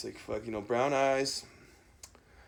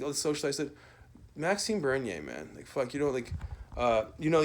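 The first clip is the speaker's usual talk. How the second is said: ordinary talk, nothing marked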